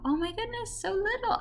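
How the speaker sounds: put-on very high, soft baby-talk coo; cooing over baby. Passing a baby in the store, high soft cooing.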